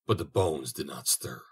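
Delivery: usual speaking voice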